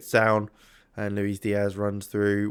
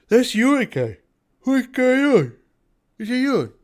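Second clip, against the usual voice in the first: high pet-talk voice, louder; 13 semitones higher, 7 dB louder than usual. Real, not acted. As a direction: coo at the cat. Talking to a pet.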